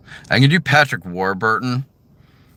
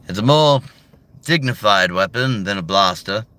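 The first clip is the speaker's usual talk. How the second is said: put-on higher, louder old gentle mentor; kindly old Jedi greeting a boy; Alec Guinness's Obi-Wan; 4 semitones higher, 4 dB louder than usual. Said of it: "you got Alec Guinness's one".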